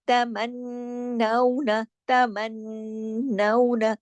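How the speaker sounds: तमन्नौना with the नन hummed long; very slow nasal recitation; full ghunna. Shown as a demonstration. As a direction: hum the double न of तमन्नौना. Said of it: "तशदीद की वजह से गुन्ना हुआ".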